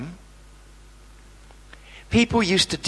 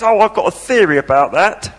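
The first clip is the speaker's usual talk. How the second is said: mock know-it-all; 3 semitones higher, 4 dB louder, faster than usual. Mocking a pious know-it-all.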